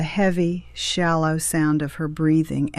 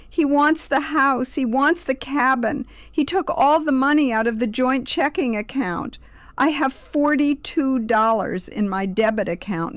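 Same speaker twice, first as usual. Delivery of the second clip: high, loud, distraught pleading; desperate daughter wailing for help; weeping panic; 9 semitones higher, 4 dB louder than usual. A narrator's reading. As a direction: pour it out in panic, pitched right up. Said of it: "the words tumbling out in a rush of panic".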